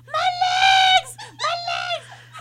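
high, slow wailing my legs with a laugh; mock pained cry. Sister mimicking her sister's cramp scream, high laughing wail. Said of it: "she was screaming in her sleep".

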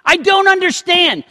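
high, loud, anguished plea; impassioned lament; crying out to God. Retold or imitated. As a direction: ache for a God who feels far. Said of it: "So he's crying out".

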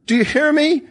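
very high, desperate demand; screaming. A narrator's reading. Lisa to unresponsive Crispin, screaming to be heard. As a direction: cry it out at the top of your range. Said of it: "She was screaming".

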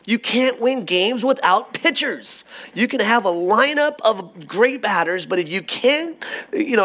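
high-pitched mock exasperation, climbing as it goes; hammed-up armchair-coach griping; sports-fan rant. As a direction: rant to a buddy like a fed-up fan. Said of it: "I immediately go into sports".

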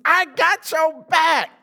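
slow, loud, high boast; cocky Peter. Retold or imitated. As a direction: stretch it out like a swaggering promise. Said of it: "same dude who told Jesus".

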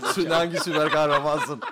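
asked through laughter, slowly; laughing. Real, not acted. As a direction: laugh out the question. Repeats question while laughing.

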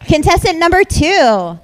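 game show host. Acted. Calling next contestant.

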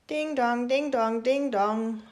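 ding dong sung high and loud like a doorbell; doorbell chime. Acted. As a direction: chime it out like a bell. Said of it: "läutete es an der Tür".

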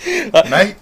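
put-on English matey accent. Shown as a demonstration. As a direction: toss it out in a mock English accent. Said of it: "can I hear your best English impersonation?".

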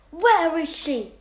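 angry, slow, loud shout of where is she; angry yell. Acted. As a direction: yell it out angrily. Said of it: "I angrily yelled".

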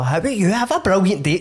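breezy friendly customer, lightly put on; lightly voiced nice customer. Nice customer greeting a shop worker, lightly mimicked and cheerful. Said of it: "they're pure".

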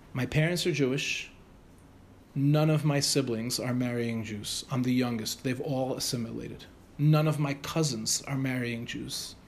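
low, slow, weighty delivery; solemn confession. Retold or imitated. Student tells teacher his family has assimilated, solemnly. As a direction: lay it out slowly and gravely, with pauses.

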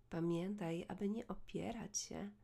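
normal talking voice

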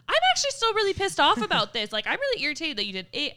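high, loud acted outburst; guns-ablaze outburst. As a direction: burst in arguing, acting out your own irritation. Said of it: "fucking guns ablaze".

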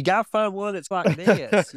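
hammed-up country accent, pitched high. Shown as a demonstration. Co-host demonstrating a new video voice, high country accent. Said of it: "a country voice might be different".

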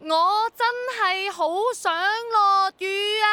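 拉長聲、高聲向天大嗌; 喺旺角街頭豁出去，慢慢叫出嚟. Acted. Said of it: "然後你就大嗌". (硬著頭皮喺街頭大嗌求雨)

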